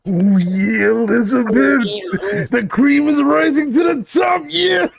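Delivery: high gravelly wrestling promo shout; over-the-top wrestler yell; Macho Man impression. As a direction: belt it like a hyped wrestling promo. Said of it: "if I talk like Macho Man".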